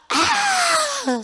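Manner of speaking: high, drawn-out scream; re-enacted scream. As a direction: cry out as if jolting awake, very high. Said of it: "소리를 지르면서 일어났어요".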